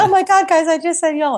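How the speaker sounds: retold squeal of disbelief to friends; shocked confession. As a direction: act scandalized at your own slip.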